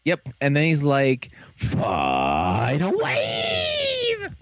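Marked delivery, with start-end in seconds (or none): 1.6-4.3 hugely drawn-out battle cry, swooping up; mock attack call. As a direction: shout the finishing move very slowly. Acted finishing-move call.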